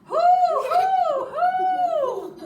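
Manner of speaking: very high, very loud hooting; owl hoot. Acted. The owl arriving and hooting at everyone. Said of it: "an owl says".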